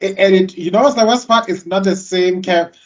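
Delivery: normal talking voice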